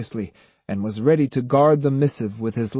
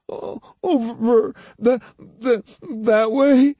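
sobbing stammer; 11 semitones higher, slower than usual. A narrator's reading. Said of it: "Between sobs, Iori stammered".

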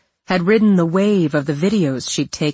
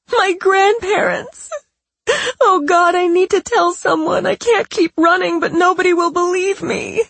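fake distressed voice; 4 dB louder than usual. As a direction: beg through fake tears. Patricia's faked distress.

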